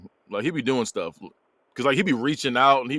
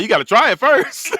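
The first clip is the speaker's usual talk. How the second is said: high, loud imitation; hammed-up punchline; 10 semitones higher, 7 dB louder than usual. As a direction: push it up high and loud for the laugh. Retold punchline quote.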